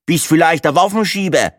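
high, loud Franconian character voice; Franconian accent. A narrator's reading. Table companion guesses Breitling's job, in a loud Franconian accent. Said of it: "fränkelte der Minenschlumpf".

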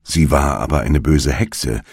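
ordinary delivery, nothing marked

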